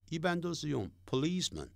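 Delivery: ordinary talk, nothing marked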